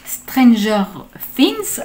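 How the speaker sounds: playful put-on English accent on the title. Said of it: "avec mon accent anglais".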